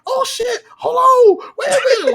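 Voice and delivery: high, strained cry of a woman begging him to wait; mock high pleading woman. Retelling the girl begging him to stop, high pleading cry. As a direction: squeal it like she can't take it.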